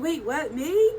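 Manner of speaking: high, rising wait what me; mock disbelief. Asked to sign her book, high amazed disbelief. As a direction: pretend shock at being asked. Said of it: "I was just like".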